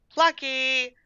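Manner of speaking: high sing-out call for a cat. A narrator's reading. Daisy searching for the lost cat, calling out. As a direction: call the name on high, held notes. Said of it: "she began to call".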